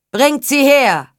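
loud, high command; called-out order. A narrator's reading. Nobleman orders the captives brought to him, calling out. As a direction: shout the order across the camp. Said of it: "rief der Edelmann".

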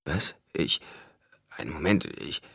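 soft, broken-off false starts; confused stammer. A narrator's reading. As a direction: stumble over the words in confusion. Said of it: "Paul bemerkte seine Verwirrung". (verwirrt stammelnd)

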